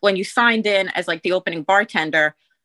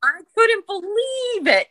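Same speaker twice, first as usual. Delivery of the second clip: disbelief; 13 semitones higher, slower than usual. Real, not acted. Recalling disbelief at boss.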